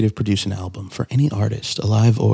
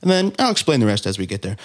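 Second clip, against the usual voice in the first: normal talking voice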